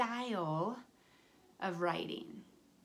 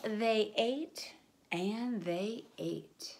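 slow drawn out; much slower than usual. Acted. Slow eating refrain.

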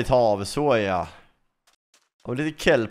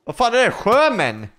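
much higher, louder alarmed yelp; startled shout; 9 semitones higher, 5 dB louder than usual. Real, not acted. Player bitten underwater, startled shout. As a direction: jump out of your skin.

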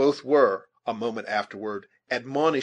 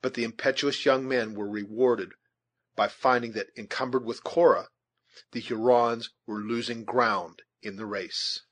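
usual speaking voice

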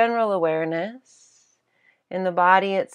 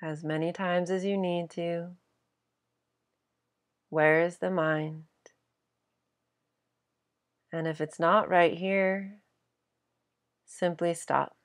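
guided meditation. Acted. Teacher guiding listeners to simply stop and be present, guided meditation. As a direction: guide them gently into stillness, leaving space between phrases.

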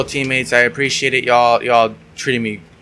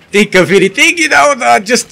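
squeaky high; 12 semitones higher, 7 dB louder than usual. Pretend.

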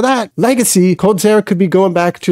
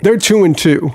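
ordinary talk, nothing marked

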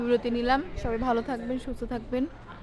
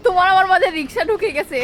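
playful loud; 5 semitones higher, 8 dB louder than usual. Pretend. Playful sung-out joke line.

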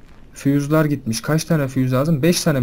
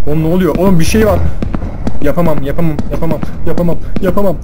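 terrified yelling; 2 semitones higher, 10 dB louder than usual. Real, not acted. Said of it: "çok korktum lan sesinden".

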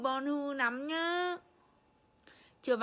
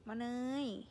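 ordinary talk

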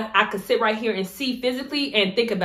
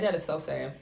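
quiet dismay; 6 semitones lower, 8 dB quieter than usual. Retold or imitated. Retold reply to a friend.

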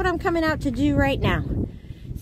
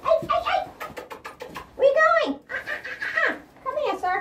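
high goat voice; 12 semitones higher, much faster than usual. Pretend.

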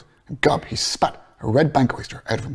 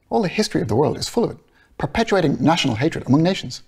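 Bloom voiced higher; 6 semitones higher than usual.

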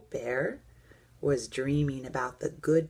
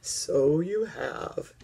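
put-on slow old teddy bear voice; slower than usual. A narrator's reading. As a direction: answer slowly as an old bear. Old Bear answers slowly.

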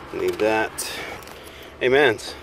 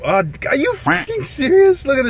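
high, disbelieving "are you fucking serious"; surprised outburst. Real, not acted. Friend in the car reacting in sudden disbelief.

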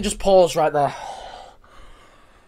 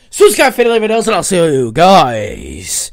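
vlog outro; 6 dB louder than usual. Acted. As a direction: pump up the viewers for subscribe. YouTube outro.

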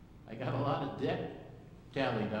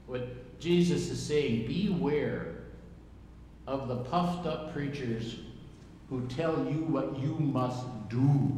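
preaching emphasis; slower than usual. Acted.

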